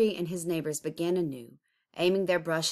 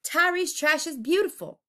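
high, loud defiant cry; shouted; 10 semitones higher, 5 dB louder than usual. Acted. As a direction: defend the art at full voice. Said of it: "others screamed".

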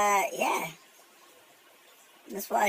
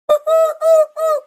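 squeaky high-pitched loud laugh; helium laugh; 12 dB louder than usual. Real, not acted. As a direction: chipmunk cackle. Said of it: "Tourette's on helium".

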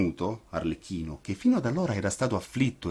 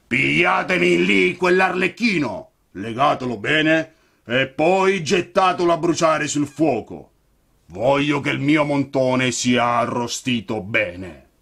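loud, higher rattling command; rasping puppet master ordering guards; 6 semitones higher, 7 dB louder than usual. A narrator's reading. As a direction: growl orders through a rattle. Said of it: "con voce rantolosa".

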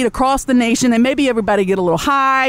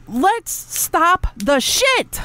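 drawn-out scolding; 3 semitones higher, much slower than usual. Real, not acted. Scolding viewers.

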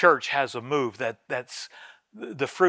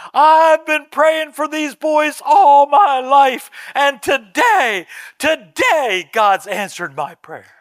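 loud, high, drawn-out cry of answered prayer; imitated tearful cry; 12 semitones higher, 9 dB louder, slower than usual. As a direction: wail it slowly as the overcome old woman did, easing at the end. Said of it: "and she's crying".

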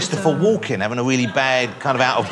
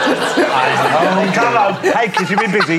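creepy uncle voice; 4 semitones higher, slower than usual. Acted.

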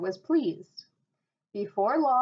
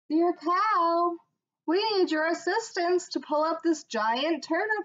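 higher, brighter wife voice calling out; wife calling to cow; 6 semitones higher than usual. A narrator's reading. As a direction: lift the voice up to call the cow. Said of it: "she cried".

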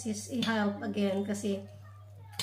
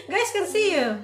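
laughing; 4 semitones higher than usual. Real, not acted. Laughing while filming son.

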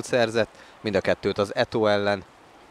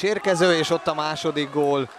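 excited goal call; 5 semitones higher than usual. Real, not acted. Second goal called. (izgatott gólkiáltás)

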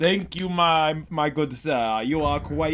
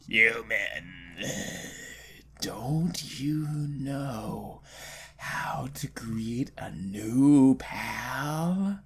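very slow, low whisper; creepy stranger; 8 semitones lower, 10 dB quieter, much slower than usual. Acted. Stranger behind the human asks a handshake, ominous whisper. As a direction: breathe it out slowly and ominously.

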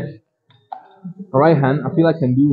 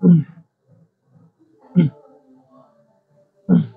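straining through last reps; 3 semitones higher, slower than usual. Real, not acted. Grinding through last set.

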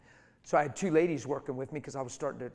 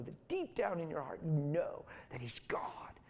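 sudden breathy whisper, intimate; hushed confiding whisper from the pulpit; 3 semitones higher, 3 dB quieter than usual. Real, not acted. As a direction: drop to a hushed whisper to draw them in. Preacher drops to a hush.

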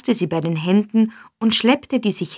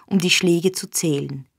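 ordinary delivery, nothing marked

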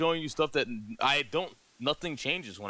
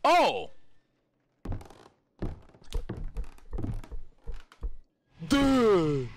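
startled yelp; 5 semitones higher than usual. Real, not acted. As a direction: jump at the scare. Jump scare in game.

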